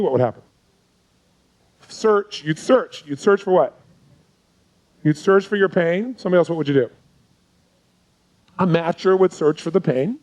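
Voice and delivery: ordinary delivery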